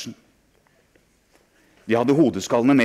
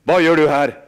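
angry demand; 6 semitones higher, 10 dB louder than usual. Acted. Angry adult catches boy.

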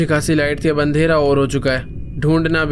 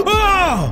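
sudden high scream of fright; startled scream. Real, not acted. Gamer jolted by a jumpscare, high yelp.

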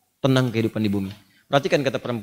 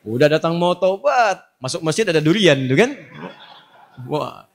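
usual speaking voice

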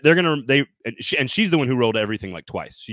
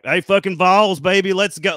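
loud, higher Southern hollering; thick Southern accent; 7 semitones higher, 6 dB louder than usual. Shown as a demonstration. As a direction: holler it like a Southern sports fan. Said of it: "change in my voice, it immediately goes to".

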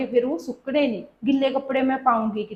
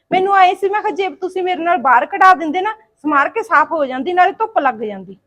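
raised, louder protest; insistent pleading; 5 semitones higher, 6 dB louder than usual. Acted. Daughter-in-law pressing mother-in-law for help with cleaning, insistent pleading. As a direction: press your case, high and loud.

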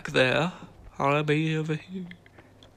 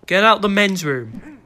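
louder, higher, slow command; insistent order; 4 semitones higher, 6 dB louder, slower than usual. Pretend. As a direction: raise the voice to send someone out.

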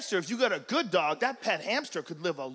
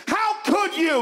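high, raised-voice reproach; pitched-up accusing cry; appalled owner; 9 semitones higher, 4 dB louder, slower than usual. Acted. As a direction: scold the dog in shocked, mock outrage. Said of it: "You scream at 'em".

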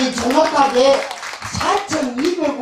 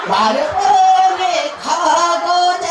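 excited shout; 6 semitones higher, 4 dB louder, much slower than usual. Real, not acted. Emcee rallying crowd, laughing shout.

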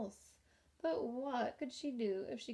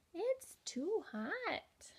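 high, slow whiny bug voice; the ladybug grumbling about the roaring tiger, high and whiny; squeaky ladybug; 8 semitones higher, much slower than usual. A narrator's reading. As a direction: drag out the complaint in a tiny voice. Ladybug character voice.

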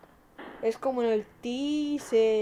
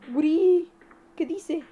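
call out; 6 semitones higher, 8 dB louder, slower than usual. Real, not acted. Shouting at chat member.

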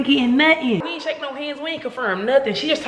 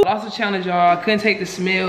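ordinary delivery

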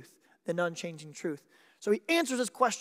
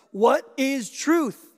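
drawn out slowly and louder, each word weighted; slow, loud challenge; 8 semitones higher, 8 dB louder, much slower than usual. Retold or imitated. Pilate challenging the silent prisoner, slow and loud. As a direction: let the question hang, slow and heavy. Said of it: "So when Pontius Pilate says".

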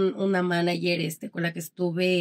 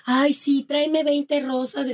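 higher, drawn-out diva request; mock demanding star; 7 semitones higher, slower than usual. Singer mocking a star's orders to a manager, higher and drawn out. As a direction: put on a pampered star ordering the manager about. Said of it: "no tanto con manager de".

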